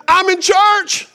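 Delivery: high, drawn-out holler into the phone; loud phone yell. Retold or imitated. Preacher imitating older man on phone during service, yelled. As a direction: yell it slowly, with no attempt at discretion. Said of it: "he wasn't quiet about it".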